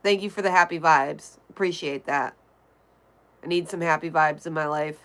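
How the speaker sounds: ordinary talk, nothing marked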